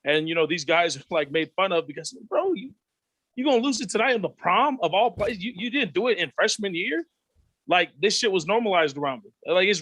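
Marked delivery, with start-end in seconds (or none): 2.3-7.0 mock peer taunt. Mocking peers voiced.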